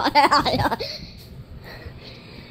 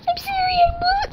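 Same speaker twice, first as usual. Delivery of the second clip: much higher, cried-out sorry; mock wailing apology; 12 semitones higher than usual. Apologising to Mom in the video, high mock wail.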